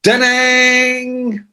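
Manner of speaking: high ringing shout of a guitar chord; guitar crash shout. Retold or imitated. Rock writers answering the phone, high shouted guitar-crash noise. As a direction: belt it like a power chord. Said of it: "we would go".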